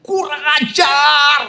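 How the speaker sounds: high, slow, loud yell; mock angry yell out in the open; yelling in the field. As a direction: bellow it out into the empty field. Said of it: "Dan saya teriak".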